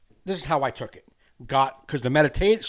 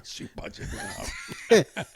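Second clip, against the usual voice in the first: repeating it through laughter, much higher; laughing; slower than usual. Real, not acted. Laughing hard at his friend's tissue budget joke. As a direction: squeal the words while cracking up.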